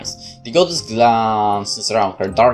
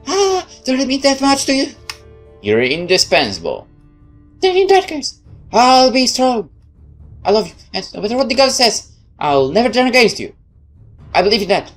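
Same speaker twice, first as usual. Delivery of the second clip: put-on timid young girl voice; 12 semitones higher than usual. Neve voiced, higher.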